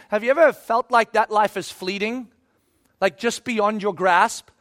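ordinary delivery

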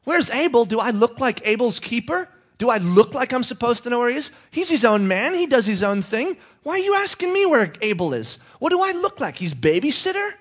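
high, loud defensive protest; Cain's high, loud defensive retort; indignant retort. Acted. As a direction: snap it back high and loud, feigning offense. Cain indignant denial.

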